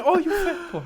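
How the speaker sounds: high, half-laughing punchline; laughing mock quote. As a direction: say it cracking up.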